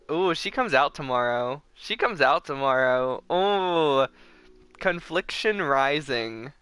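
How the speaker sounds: ordinary talk, nothing marked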